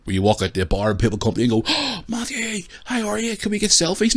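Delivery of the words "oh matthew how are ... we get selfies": excited fan voice. Retold or imitated. Fans greeting him in bar.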